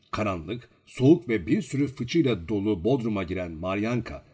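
plain reading voice

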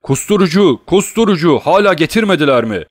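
high, loud frantic demand; urgent shouting. A narrator's reading. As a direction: shout impatiently for help to be brought. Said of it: "Wilford kapıya doğru koşarak bağırdı".

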